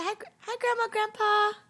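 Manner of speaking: put-on loud, high "Grandma, Grandpa" for the baby; loud coaxing prompt. Shown as a demonstration. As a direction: call out the greeting for the baby to repeat. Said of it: "Can you say".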